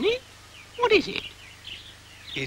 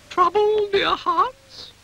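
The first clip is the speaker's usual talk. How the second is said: high fussy cow; 4 dB louder, slower than usual. Acted. Cow fusses over upset dog.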